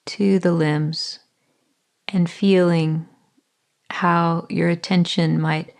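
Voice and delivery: calm body-scan voice with long pauses; guided meditation. Acted. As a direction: ease the listener into their body, unhurried.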